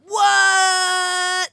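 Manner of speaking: high, loud startled shriek; shocked shout. Real, not acted. Streamer stunned by the flirty whiskey line, shrieking. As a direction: yelp it like you can't believe it. Shocked by own joke reply.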